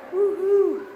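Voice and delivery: high, loud happy cheer; joyful whoop. Real, not acted. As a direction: whoop out with delight. Calling out joyfully in cave.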